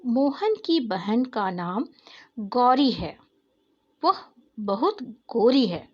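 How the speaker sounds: sentence read slowly, each word apart; teacher reading a model sentence slowly; slow dictation reading. Shown as a demonstration. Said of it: "पढ़ो और अंतर समझो".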